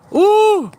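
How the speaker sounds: very high, loud hype yell; excited high whoop. Real, not acted. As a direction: let out a wild whoop. Celebratory whoops.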